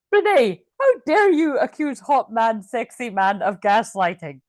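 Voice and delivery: slow, loud, hammed-up indignation; mock outrage. Mock indignation voiced as offended objector.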